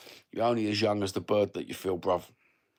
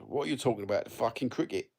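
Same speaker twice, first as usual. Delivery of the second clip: ordinary talk, nothing marked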